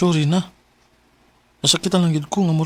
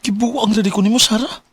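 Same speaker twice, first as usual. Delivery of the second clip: disbelief; 7 semitones higher than usual. Acted. Disbelief at breakup.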